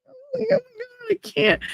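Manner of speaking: high, soft, crying "Oh my God, I can't"; retold crying. Hearing about the baby in a jar, crying out high and soft.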